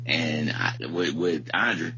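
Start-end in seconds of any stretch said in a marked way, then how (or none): none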